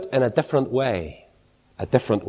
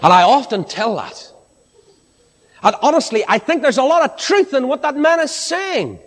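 higher, lifted preaching voice, rising to joy; full-force preaching; 11 semitones higher than usual. Acted. Preacher to the congregation on joy: exultant, higher preaching.

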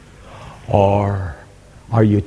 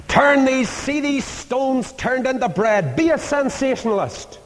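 mock tempter. Voicing Satan tempting Christ.

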